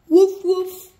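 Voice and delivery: high, loud yappy puppy barks. Acted. Reader barking as Biscuit the puppy, high and loud.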